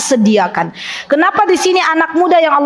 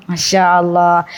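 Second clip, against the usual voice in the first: ordinary delivery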